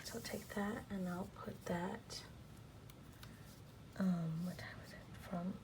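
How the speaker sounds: mostly unvoiced, quiet whisper; soft planner whisper. Vlogger whispers her plans to viewers while writing, soft whisper. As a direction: murmur to yourself while writing.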